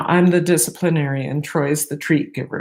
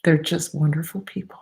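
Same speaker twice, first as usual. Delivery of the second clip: ordinary talk, nothing marked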